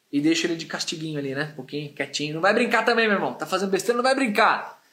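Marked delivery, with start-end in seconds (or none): none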